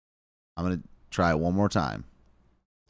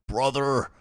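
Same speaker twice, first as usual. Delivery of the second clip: high-pitched wrestler impression; hammed-up wrestling-star voice, higher; Hulk Hogan; 6 semitones higher, 3 dB louder than usual. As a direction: try a put-on pro-wrestler voice, pitched up. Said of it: "I'm doing Hulk Hogan or Randy Savage".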